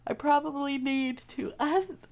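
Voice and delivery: high-pitched talk through a yawn; words stretched up by a real yawn. Real, not acted. Streamer chatting to viewers while yawning, yawning. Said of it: "I'm done yawning".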